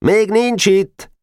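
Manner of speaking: high, slow called-out reply; answering call. A narrator's reading. A voice answering the searching boy, calling back high. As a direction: call it back across the yard. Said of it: "Egy hang felelt".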